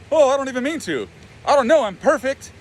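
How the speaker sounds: high, put-on falsely modest voice; mocking fake humility. Inner critic imitates him as Mister Humble, high mocking voice. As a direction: parody someone being oh-so-humble. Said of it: "Now you're being all Mister Humble".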